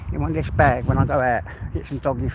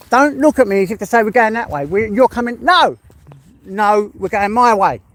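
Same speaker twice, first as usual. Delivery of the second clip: loud, higher-pitched telling-off; scolding the dog; 5 semitones higher, 5 dB louder than usual. Real, not acted. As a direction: firmly overrule a stubborn dog.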